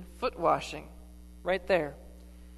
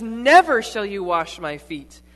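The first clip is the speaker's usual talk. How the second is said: loud refusal; 10 dB louder than usual. Acted. Peter refuses Jesus washing him.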